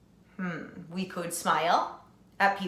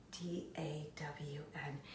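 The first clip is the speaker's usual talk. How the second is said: whisper; 5 semitones lower, 18 dB quieter than usual. Acted. Spelling her name softly.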